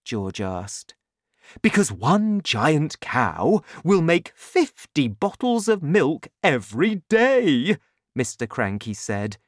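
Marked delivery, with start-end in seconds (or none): 1.6-7.8 loud, higher, emphatic boasting; excited father. A narrator's reading. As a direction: rave about your grand plan. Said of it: "waving his arms in the air".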